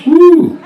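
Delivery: high, loud cartoon whoop; Homer Simpson whoop. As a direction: whoop it like an excited cartoon dad. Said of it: "Homer comes back to the house".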